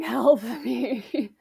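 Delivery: inner scream. Retold or imitated. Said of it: "inside I was, like, screaming".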